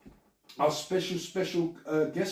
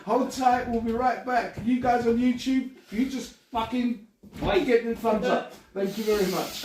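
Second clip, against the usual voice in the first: rally the YouTube viewers before the break; host sign-off; 6 semitones higher than usual. Acted. Podcast host wraps up for YouTube viewers, hyped announcer voice.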